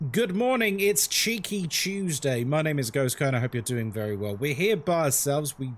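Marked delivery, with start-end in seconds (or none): 0.1-3.3 stream intro. Acted.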